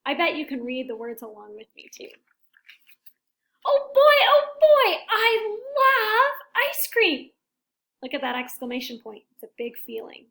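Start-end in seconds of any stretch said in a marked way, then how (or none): 3.6-7.3 much higher, louder, stretched slow cry of joy; overjoyed character. Acted. Elephant overjoyed at ice cream, high loud squeal. Big feeling exclamation point.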